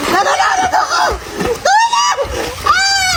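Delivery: very high drowning screams; hammed-up drowning panic; panicked cry for help. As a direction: shriek for help as if going under. Acted drowning, calling for help.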